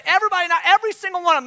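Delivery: fast, high mock-aunt insistence; emphatic aunt impression. Aunt listing the family's kids, high and emphatic.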